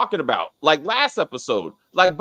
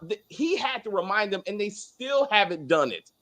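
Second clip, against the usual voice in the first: ordinary talk, nothing marked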